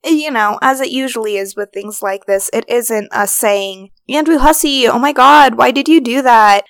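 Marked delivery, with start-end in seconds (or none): 4.1-6.6 mock exasperated. Mock exasperated address to Andrew.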